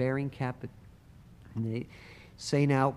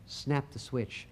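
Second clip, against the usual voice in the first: usual speaking voice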